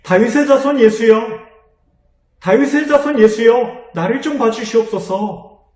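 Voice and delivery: a blind beggar calling out to Jesus, urgent and loud; urgent cry. Acted. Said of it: "다급하게 부르짖었습니다".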